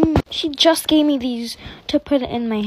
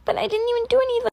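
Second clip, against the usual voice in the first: whiny protest; 11 semitones higher, much faster than usual. Acted. Wrongly accused student protests.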